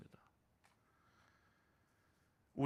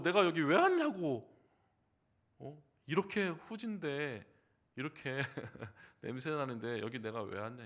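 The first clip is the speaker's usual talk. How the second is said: 왔냐고 louder and indignant; mock angry complaint; 2 semitones higher, 6 dB louder than usual. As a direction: scold the family like a disgusted ghost. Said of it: "화를 내면서 막 애통하면서".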